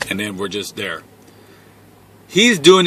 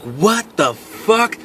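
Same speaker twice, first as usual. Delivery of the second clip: high, drawn-out disbelief, rising at the end; bewildered dismay; 7 semitones higher, 3 dB quieter, much slower than usual. Retold or imitated. Man still smelling her after showering, bewildered dismay. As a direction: stretch it slowly upward in baffled horror.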